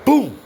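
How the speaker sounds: loud, high "boom"; slam sound. Retold or imitated. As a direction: punch out the boom of a slammed door. Imitates the door slamming.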